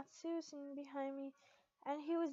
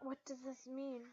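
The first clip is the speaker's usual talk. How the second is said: ordinary delivery, nothing marked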